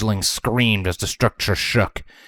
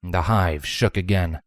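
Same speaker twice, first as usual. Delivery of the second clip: usual speaking voice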